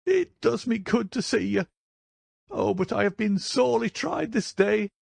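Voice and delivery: put-on very high-pitched old woman, overwrought; high, emotional old housekeeper; hysterical, tearful cry. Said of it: "cried with an hysterical sob".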